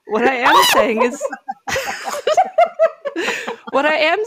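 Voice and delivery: breaking into laughter mid-sentence; laughing. Real, not acted. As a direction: crack up while reading the note. Reading son's funny note, cracking up.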